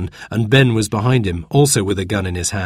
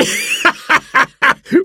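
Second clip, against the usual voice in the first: jeering pirate laugh. A narrator's reading. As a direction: cackle at an easy victim. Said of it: "laughed another pirate".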